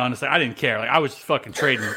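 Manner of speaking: ordinary talk, nothing marked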